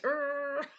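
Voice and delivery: short strained grunt while scrubbing; mock effort grunt. Showing the noise she makes washing her hair, a strained grunt. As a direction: push out a little effort grunt. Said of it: "I make the noise".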